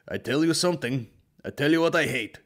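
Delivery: hammed-up heavy Eastern European grumble; Eastern European accent. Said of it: "something about those Eastern Europeans".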